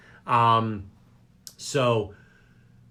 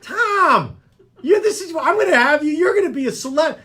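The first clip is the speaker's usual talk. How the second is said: much higher and louder, bursting with excitement; excited outburst; 6 dB louder than usual. Real, not acted. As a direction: cheer the guest on like he's a star. Host hypes up shy guest.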